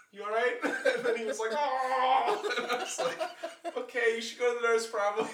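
high, loud, giggling retold lines; barely holding it together, laughing; quoting yourself while cracking up, high and loud; laughing through a quote. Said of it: "So I just went".

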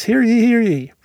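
loud, high proclamation call; town crier. Pretend. Podcast business announcement, calling out.

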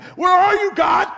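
high shout to God; frantic shout. Retold or imitated. Preacher voicing believers who suddenly call for God. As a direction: yell it out, suddenly panicked. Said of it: "and we're like".

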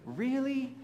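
high, disbelieving really; mock incredulous question. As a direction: jump up in pitch in disbelief. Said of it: "you're like".

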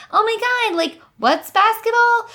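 high, slow airhead voice; mock clueless girl, way up high; playing dumb. Woman faking ignorance to a guy, high ditzy voice. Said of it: "just act like an idiot".

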